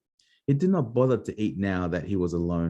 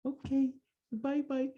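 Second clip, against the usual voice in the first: much higher, soft sing-song bye-bye; sing-song goodbye; 5 dB quieter than usual. Real, not acted. Saying goodbye to the child, high soft sing-song. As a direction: wave bye-bye in a cooing voice.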